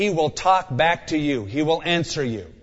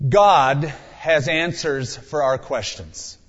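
usual speaking voice

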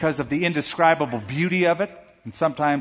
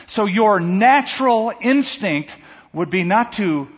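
usual speaking voice

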